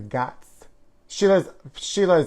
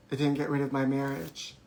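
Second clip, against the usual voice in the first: normal talking voice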